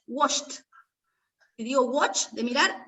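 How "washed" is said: "washed" ending 'sht', one syllable; final T sound. Shown as a demonstration. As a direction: model "washed" with -ed as 't'. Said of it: "va a ser T". ("washed" con la -ed pronunciada 't')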